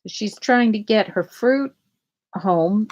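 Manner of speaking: ordinary delivery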